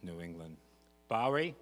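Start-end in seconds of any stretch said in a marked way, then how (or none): 1.1-1.6 put-on mom's Boston accent, called out loud and high; loud Boston mom calling out. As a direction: call it out in a mother's Boston accent. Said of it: "Boston accent".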